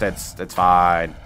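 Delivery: slow, low deadpan "it's fine"; slow ironic calm. Acted. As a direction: drag it out, pretending all is well. Ship broken, ironic calm.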